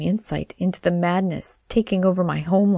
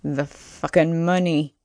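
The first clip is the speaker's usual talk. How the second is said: growl. Acted. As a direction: growl it out, lightly menacing. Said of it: "He growls".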